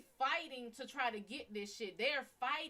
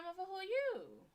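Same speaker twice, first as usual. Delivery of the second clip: startled retort; 9 semitones higher, faster than usual. Retold or imitated. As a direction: fire it back high and startled. Startled by stranger in room.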